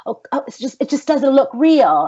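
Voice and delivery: hammed-up British accent, rising high and loud; bad British accent. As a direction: imitate a fussy British director, badly. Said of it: "very horrible job at a British accent".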